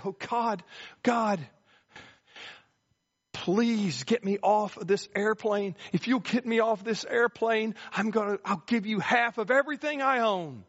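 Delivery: panicked plea as the plane shakes; frantic cry. Retold or imitated. Scared businessman begs God to save him, high and pleading. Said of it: "he cries out".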